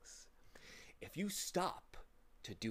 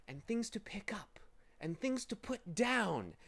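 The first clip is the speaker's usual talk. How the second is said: lightly wheedling, coaxing villain; pleasing, deceitful voice; 5 semitones higher than usual. A narrator's reading. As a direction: lure them in with false sweetness. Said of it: "soft, pleasing, deceitful voice".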